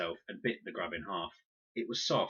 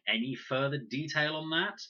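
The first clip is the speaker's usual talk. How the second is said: sarcastic; 8 semitones higher, 5 dB louder than usual. A narrator's reading. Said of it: "Even her teeth looked sarcastic".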